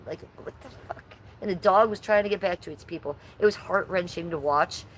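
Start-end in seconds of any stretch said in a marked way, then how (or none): 0.1-1.0 very soft, voiceless aside; whispered disbelief. Real, not acted. Streamer reacts to a grown mom's baby talk, whispered disbelief. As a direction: whisper it under your breath, incredulous. Aghast aside after imitation.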